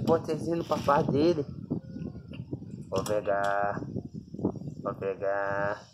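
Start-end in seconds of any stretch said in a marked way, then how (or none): 2.9-5.8 low, soft, slow teasing threat; mock menacing hush. Owner slowly threatening to take the dog's food, hushed. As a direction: creep toward the dog's food. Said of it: "vou fazer que eu vou pegar".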